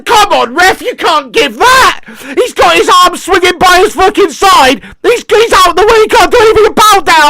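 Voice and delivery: high, loud shouting at the ref; outraged protest. Real, not acted. Fan shouting at the referee over the penalty call, outraged.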